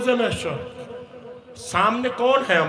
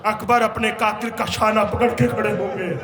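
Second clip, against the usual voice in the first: impassioned lament preaching; 5 semitones higher than usual. Acted. Preacher recounting Ali Asghar's martyrdom to mourners, impassioned lament. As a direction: preach the tragedy in stretched, ringing phrases.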